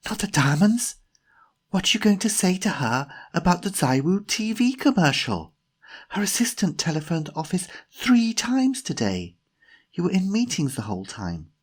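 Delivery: high, agitated stage whisper; whisper-scold in a high, urgent voice. A narrator's reading. Jemima reminds Timothy about the commercial, agitated high whisper. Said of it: "whispered Jemima".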